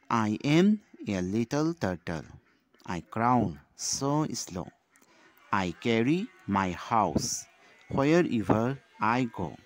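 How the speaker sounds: slow, loud classroom recitation; measured poem read-aloud for small children. Shown as a demonstration. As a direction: read the verse out slowly and loudly, pausing between phrases. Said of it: "Again, I will read out the poem".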